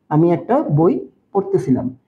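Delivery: ordinary talk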